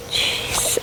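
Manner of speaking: breathy whispered jeez. Real, not acted. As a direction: whisper it under your breath. After running over character.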